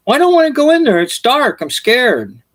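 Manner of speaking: put-on high whiny kid, fading down; a lightly voiced frightened boy; scared child. Johnny refusing his mother, high and whiny.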